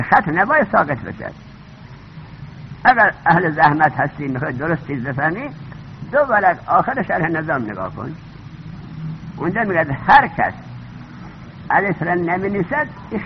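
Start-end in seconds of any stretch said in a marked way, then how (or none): none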